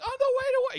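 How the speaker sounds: loud, very high falsetto; Abby losing it. Acted. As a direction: shriek it back in disbelief. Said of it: "like, literally losing it".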